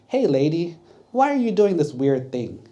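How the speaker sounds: calling out. Retold or imitated. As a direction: call out to her with nosy surprise. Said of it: "Abby's like".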